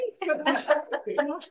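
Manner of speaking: a high, breathy burst of laughter. Real, not acted. Laughing at the clerk who nearly fainted, high burst of laughter.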